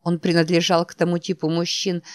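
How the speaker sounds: usual speaking voice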